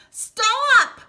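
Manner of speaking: high, loud desperate yell. Acted. Big Anthony to the overflowing pot, desperate yell. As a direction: bark the word at the runaway pot. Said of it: "yelled Big Anthony".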